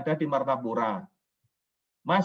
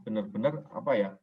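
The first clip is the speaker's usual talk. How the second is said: ordinary delivery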